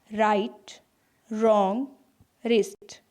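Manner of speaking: राइट, रॉन्ग, रिस्ट with no w sound; silent w demo. Shown as a demonstration. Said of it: "तो यहाँ राइट बोला जा रहा है". (w सुनाई न दे, राइट रॉन्ग रिस्ट)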